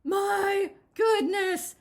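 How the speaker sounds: very high, loud, slow gasp. A narrator's reading. Granny gasping at huge Jigsaw, slow and breathless. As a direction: heave it out, winded. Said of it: "gasped Granny".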